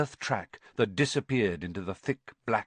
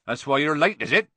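louder, higher, accusing gamekeeper; frowning retort; 6 semitones higher, 6 dB louder, faster than usual. A narrator's reading. Hagrid confronting Filch over lateness, annoyed. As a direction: call him out for lecturing. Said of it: "frowning at Filch".

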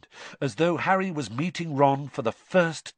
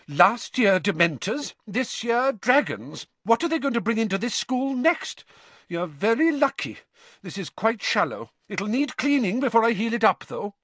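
high, exasperated nurse rant; furious scolding; 10 semitones higher than usual. A narrator's reading. Madam Pomfrey scolding as she treats Harry, furious. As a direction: scold while you work. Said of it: "talking furiously all the while".

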